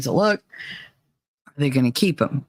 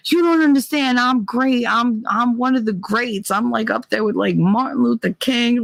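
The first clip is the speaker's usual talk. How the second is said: mock grandiose boyfriend; 6 semitones higher than usual. Mocking boyfriend's grandiose texts.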